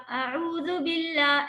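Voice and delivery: recitation style. Shown as a demonstration. Teacher showing students the correct opening, slow measured recitation. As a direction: intone it fully, stretching each long vowel. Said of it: "جیسے آپ تلاوت کرتی ہیں اسی طرح سے".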